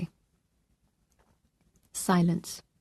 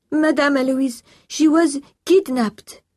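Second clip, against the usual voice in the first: put-on high, loud French-accented alarm, ending slowly; distressed French housekeeper; 8 semitones higher, 7 dB louder, slower than usual. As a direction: break terrible news as an agitated French housekeeper. Housekeeper breaks kidnapping news.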